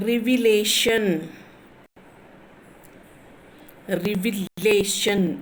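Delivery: Revelation with weight on the syllable లే; stress on LE. Shown as a demonstration. Teacher showing word stress, revelation stressed on లే. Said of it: "LA అనేది మీరు ఎంత గట్టిగా stress చేస్తున్నారు".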